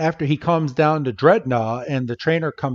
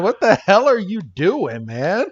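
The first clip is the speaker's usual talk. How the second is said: loud, higher mock outrage; taken aback; 5 semitones higher, 4 dB louder than usual. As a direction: protest in startled disbelief. Ash startled at being licked.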